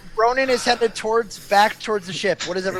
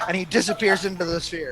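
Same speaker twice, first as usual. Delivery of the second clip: ordinary talk, nothing marked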